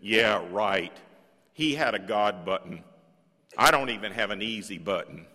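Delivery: a lightly voiced sneering imp; mock the sincere advice like a scoffing imp; giggly sneering imp. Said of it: "a little impish voice in the back of my head".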